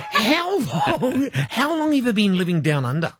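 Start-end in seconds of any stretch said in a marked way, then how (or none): none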